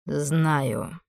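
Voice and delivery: low, soft and calm. A narrator's reading. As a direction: answer calmly, unbothered. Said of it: "спокойно ответил демон".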